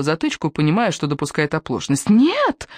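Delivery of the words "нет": high, loud furious wail; furious child's yell. A narrator's reading. Small son screams at mother pulling the plug, furious. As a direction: scream no like an enraged little boy. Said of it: "в ярости завопил Зигги".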